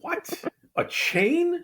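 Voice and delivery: can't believe she said a chain; surprised. Real, not acted. Shocked by Buffalo Wild Wings.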